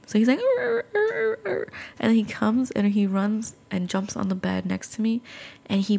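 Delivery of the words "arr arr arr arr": very high whimpering dog whine; dog whining. Retold or imitated. Imitating her frightened dog whining at the doorway, high whimper. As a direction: whimper like a scared little dog. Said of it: "he starts whining".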